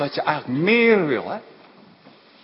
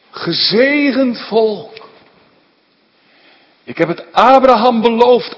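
raised, solemn divine voice at full force; preaching as God; 5 semitones higher, 5 dB louder than usual. Acted. God speaking to Biliam in solemn, raised preaching. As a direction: proclaim the blessing like God himself.

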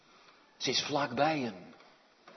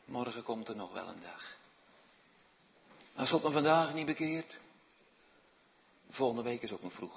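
calm, complacent; 2 semitones lower than usual. Acted. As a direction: pray as if nothing is pressing. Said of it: "zo rustig en zo kalmpjes". (kalmpjes, berustend gemompeld gebed)

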